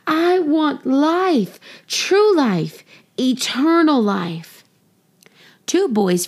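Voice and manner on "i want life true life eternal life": shouting. A narrator's reading. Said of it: "kept running and shouting".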